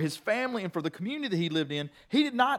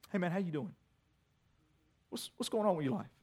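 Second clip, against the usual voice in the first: softer friendly check-in; friendly greeting; 9 dB quieter, faster than usual. Shown as a demonstration. Pastor showing congregation a kind greeting, soft and friendly. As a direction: ask gently like a friend. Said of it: "started this way".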